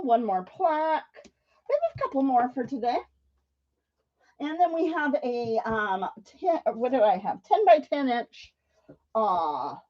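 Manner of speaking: usual speaking voice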